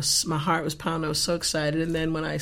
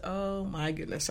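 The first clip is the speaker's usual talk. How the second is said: exasperated sigh; 8 dB quieter than usual. Retold or imitated. Exasperated aside.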